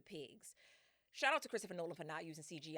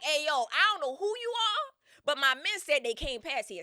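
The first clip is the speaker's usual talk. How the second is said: loud, higher call up the hill; 7 semitones higher, 12 dB louder than usual. Retold or imitated. Odysseus calling out to Circe on the hill, loud. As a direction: yell it up to a stranger. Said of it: "He like".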